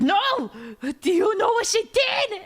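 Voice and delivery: high, loud, indignant half-laughing protest; a mother fuming at the cops; outraged mom. Retold or imitated. Furious mom answering the officers.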